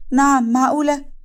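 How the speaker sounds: stunned disbelief. Acted. Shocked at hidden family secret.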